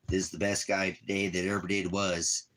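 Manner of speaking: usual speaking voice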